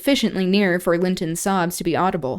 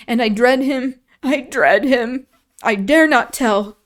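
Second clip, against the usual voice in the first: gasping dread; 4 semitones higher, 4 dB louder than usual. Acted. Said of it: "gasped the boy".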